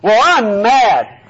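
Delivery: loud, slow, drawn-out mock grumble; mock angry retort. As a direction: play a grumpy churchgoer digging in his heels. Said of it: "Cease from anger".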